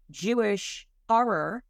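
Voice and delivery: ordinary delivery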